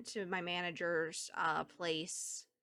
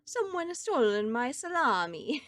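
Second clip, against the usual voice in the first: lightly put-on Italian accent, a bit higher and louder; mock Italian complaint, hammed up only a touch; 5 semitones higher, 5 dB louder than usual. Streamer voicing a game character missing his salami, mild mock Italian accent. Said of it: "Italian accent".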